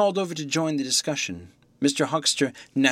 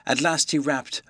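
ordinary delivery, nothing marked

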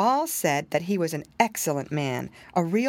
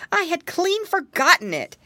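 annoyed exclamation; 10 semitones higher, 5 dB louder than usual. Acted.

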